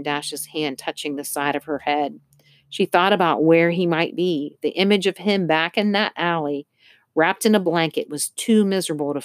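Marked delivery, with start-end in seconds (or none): none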